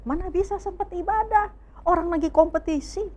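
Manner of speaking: a touch of a bratty excuse; dismissive excuse. Retold or imitated. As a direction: wave it away like a busy teen. Voicing the kid's excuse.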